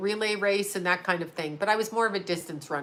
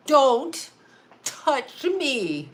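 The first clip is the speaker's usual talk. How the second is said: mad pet; 5 semitones higher, much slower than usual. Acted. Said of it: "Mew's mad".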